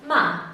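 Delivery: "Ma" short, falling sharply; fourth tone. Shown as a demonstration. Teacher modelling for repetition, "Ma" falling. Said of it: "Repeat after me".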